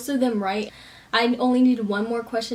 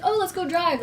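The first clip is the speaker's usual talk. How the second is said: eager; 8 semitones higher than usual. Retold or imitated. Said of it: "Be like".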